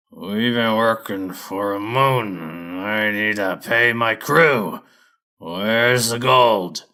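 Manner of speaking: slow, loud growl of a hairy brute; growling lumber boss. Acted. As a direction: drag the words out like a tough crew boss. Fur demands his pay.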